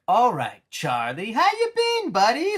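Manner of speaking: mock over-friendly Joker; fake friendly Joker. Joker greeting Charlie like a best friend, fake cheer. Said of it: "acted like he's, like, his best friend".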